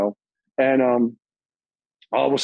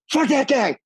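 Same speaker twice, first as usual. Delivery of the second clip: much higher, blurted; excited; 11 semitones higher than usual. Retold or imitated. Answering Chris's offer, high excited blurt. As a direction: toss it off with a grin. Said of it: "I go".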